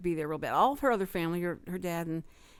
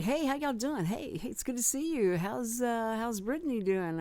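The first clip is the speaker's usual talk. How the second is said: higher-pitched, breezy cocky greeting; 4 semitones higher than usual. Retold or imitated. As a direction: imitate a too-confident contestant chatting up the judges. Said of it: "she was just real cocky".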